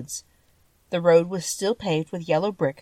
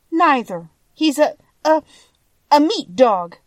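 put-on higher, louder girl searching for the word; hesitant girl; 8 semitones higher, 7 dB louder than usual. A narrator's reading. Dorothy tells the lion what Toto is, hesitant girl. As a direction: grope for the answer, pitched up.

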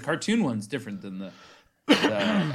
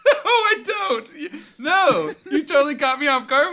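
higher, louder laughing protest; 13 semitones higher, 8 dB louder than usual. Real, not acted. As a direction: talk through laughter. Caught off guard laughing.

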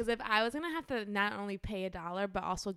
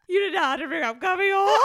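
exasperated; 9 semitones higher, 9 dB louder, faster than usual. Retold or imitated. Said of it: "I was like".

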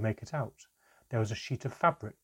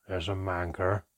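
snapping; 3 semitones lower than usual. A narrator's reading. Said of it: "Handan snapped back".

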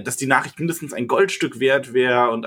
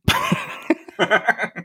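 high laugh; 9 semitones higher than usual. Real, not acted. Laughing at a joke about the innkeeper.

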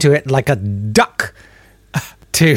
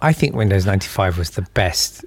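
mock serious; 6 semitones lower, 4 dB quieter than usual. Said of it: "I was just doing a joke".

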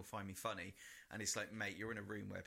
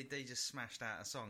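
ordinary talk, nothing marked

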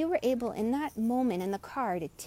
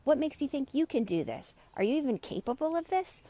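mocking doubtful inner critic, pitched higher; 6 semitones higher than usual. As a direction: needle yourself like a snide inner voice. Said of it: "that inner critic is the first one to jump up and say".

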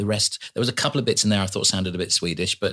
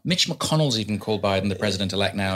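normal talking voice

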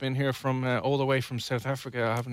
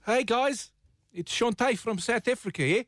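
much higher-pitched South African accent attempt; 8 semitones higher than usual. Host retries after hearing the caller, high South African accent. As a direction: imitate a South African caller, lifting the last word. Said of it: "let's try that again".

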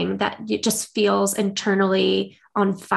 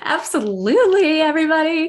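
bright, higher, louder hello to everyone; excited greeting; 6 semitones higher, 3 dB louder than usual. Real, not acted. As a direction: greet the listeners with a big burst of energy. Enthusiastic greeting to the audience.

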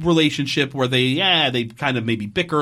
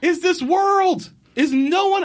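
exasperated disbelief; slower than usual. Real, not acted. Exasperated rant about the show.

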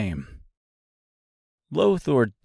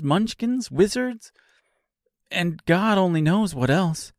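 overwhelmed, voice getting higher; rising disbelief; 7 semitones higher than usual. Acted. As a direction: pitch climbing with each question.

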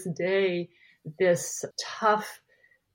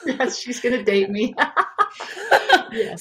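laughing; 5 semitones higher, 5 dB louder than usual. Real, not acted. Joking about being dated by the eighties.

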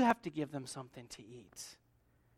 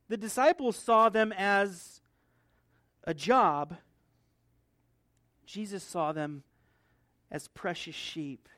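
ordinary delivery

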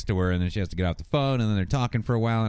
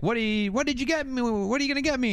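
high-pitched imitation of eager girlfriend; mock eager; 13 semitones higher than usual. Girlfriend asks boyfriend about her present, mock eager. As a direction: pitch it way up, greedy for the gift.

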